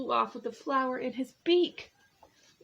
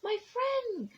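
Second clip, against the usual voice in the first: tiny voice; 13 semitones higher than usual. A narrator's reading. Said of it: "came the voice".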